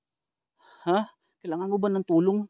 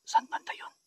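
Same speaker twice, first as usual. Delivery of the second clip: whisper; 8 dB quieter than usual. Real, not acted. Ghost hunt, hears a voice.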